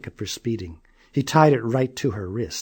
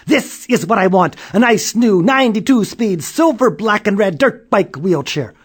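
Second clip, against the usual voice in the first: higher and louder, eager; excited child; 8 semitones higher, 7 dB louder than usual. A narrator's reading. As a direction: want it badly, gushing. Child demanding fancy wheelchair.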